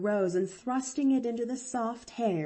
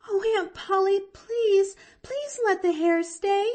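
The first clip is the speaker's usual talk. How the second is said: pleading; 14 semitones higher, 6 dB louder than usual. A narrator's reading. Girl begging aunt.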